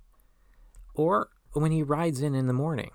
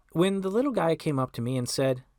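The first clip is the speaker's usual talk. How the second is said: plain reading voice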